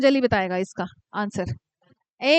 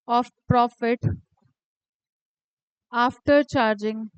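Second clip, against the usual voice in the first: slow dictation; 4 semitones lower, much slower than usual. Shown as a demonstration. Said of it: "dictating the question".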